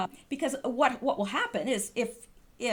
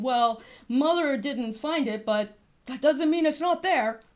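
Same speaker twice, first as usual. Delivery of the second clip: sarcastic parody of their excuse, a bit louder; mock the excuse with a sneer; scornfully imitating the other side; mocking quote; 4 dB louder than usual. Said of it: "all they have left is saying".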